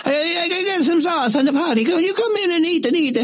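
put-on high Italian old-woman voice, chuckling; a fussy old Italian lady stalling, with laughs; Italian granny. Old Italian killer offers the detective food, high accent.

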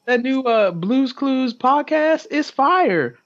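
put-on very high kid voice, drawn out; excited schoolkid. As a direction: play a kid raving to friends. Kid on playground quoted.

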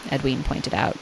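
ordinary delivery

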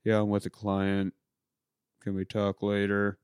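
low, soft brush-off; lightly voiced husband keeping a call short; subdued, quietly putting someone off; glum husband.

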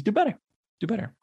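high-pitched chiding; short, high-pitched admonishment; scolding. Real, not acted. Scolding the store to camera.

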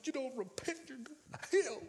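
a hint of the corner screamer warning you; street preacher. Street preacher warning young Scott, lightly mocked. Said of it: "he was screaming and yelling".

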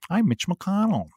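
a hint of a sleepy old senator; Mitch McConnell impression. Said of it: "I don't know his exact voice, but I know it's like that".

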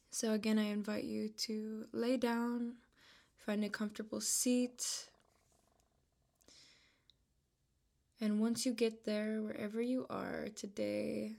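calm, unhurried voice with long pauses between phrases; measured, even-toned meditation guide; guided meditation. Real, not acted. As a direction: ease the listener into relaxation, leaving room to breathe.

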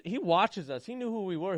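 usual speaking voice

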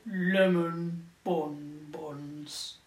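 slow, neighing unicorn voice; whinnying unicorn. Acted. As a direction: stretch it out like a horse whinny. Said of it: "He whinnied".

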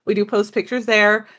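normal talking voice

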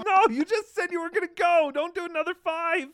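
high-pitched, loud exasperated pleading; exasperated protest. Real, not acted. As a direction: cry out in disbelief at his choice. Reacting to streamer betting again.

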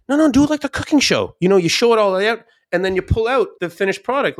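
high and loud, eager to convince; retold eager push to his wife; excited insistence. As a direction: pitch the idea like a cooking show fan. Insisting to wife, cooking show.

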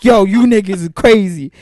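loud, high, half-sung disbelief; incredulous outburst. Retold or imitated. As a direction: belt it out like you can't believe it. Retelling his reaction to the delay.

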